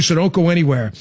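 normal talking voice

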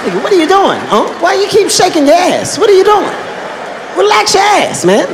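high-pitched, exasperated demand; exasperated scolding. Retold or imitated. Nephew scolds uncle for shaking while training, exasperated. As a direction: pester him with rising disbelief.

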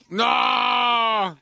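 long, loud, high wailing "no"; wailed, stretched-out shout; mock dismay. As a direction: cry out a drawn-out no in dismay. Mock dismay at listener's story.

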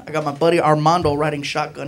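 normal talking voice

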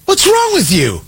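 high, incredulous outburst; exasperated. Real, not acted. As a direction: demand it, baffled and fed up.

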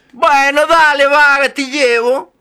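put-on high, loud teasing old lady; Madre Nieve. Madre Nieve teasing the girl as she sends her home, high and loud.

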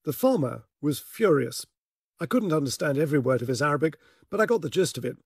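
normal talking voice

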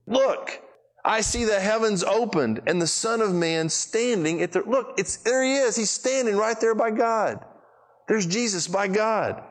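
high, bright cry of Look; awed excitement. Acted. Stephen crying out to the crowd in awe. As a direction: call out Look as if pointing at heaven. Stephen seeing Jesus standing.

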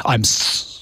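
a breathy, choked-off mock apology; Fonz can't say sorry. Host recalling Happy Days, choked mock Fonz. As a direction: imitate the Fonz choking on sorry. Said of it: "any time he had to go".

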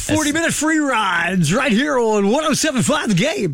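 slow, booming announcer shout; hammy old-school radio promo read, stretched out. Pretend. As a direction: oversell the station ID like a vintage jock. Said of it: "That, that's how they taught you".

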